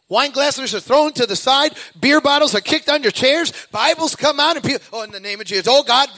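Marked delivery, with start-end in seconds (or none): 4.9-6.1 mock panic prayer. Panicked passengers praying in turbulence.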